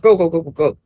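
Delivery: normal talking voice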